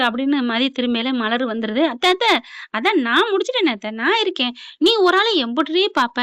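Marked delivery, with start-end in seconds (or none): 1.9-6.2 higher, louder coaxing plea; older sister defends Mathi, higher pleading voice; pleading sister-in-law. Retold or imitated. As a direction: call out to auntie, plead her case. Malar pleads with aunt.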